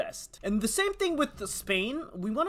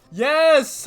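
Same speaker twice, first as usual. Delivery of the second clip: excited; 6 semitones higher, 11 dB louder than usual. Real, not acted. Alliance won.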